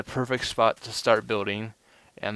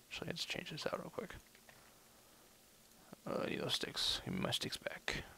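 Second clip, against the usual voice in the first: whispering; 7 semitones higher, 14 dB quieter than usual. Real, not acted. Suddenly whispers to himself.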